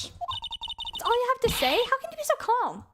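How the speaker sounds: indignant high voice. Acted. Voicing indignant game character.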